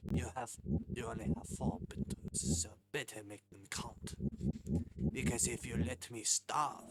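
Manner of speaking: mock-French chef laying down terms; hammed-up French customer, badly faked; French chef host. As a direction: issue the challenge in a fake French accent. Reads French chef character's lines.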